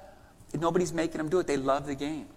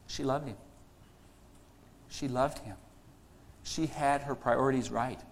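sermon delivery; 3 semitones lower than usual. Acted. Pastor to congregation, earnest sermon voice. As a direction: preach it to the congregation. Preacher building a sermon point.

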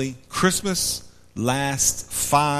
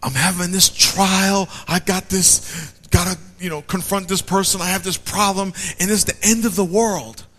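mock panic; 6 semitones higher than usual.

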